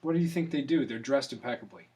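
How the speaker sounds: normal talking voice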